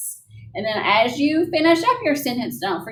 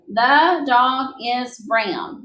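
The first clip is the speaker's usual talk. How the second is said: read slowly as an example; much slower than usual. Shown as a demonstration. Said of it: "Then I have".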